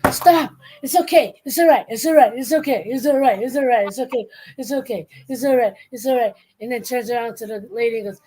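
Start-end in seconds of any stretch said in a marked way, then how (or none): 0.1-6.3 high, repetitive mock reassurance; hammed-up hushing in short bursts; frantic self-soothing. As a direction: imitate someone calming the voices in his head. Acting bellboy hushing his inner voices.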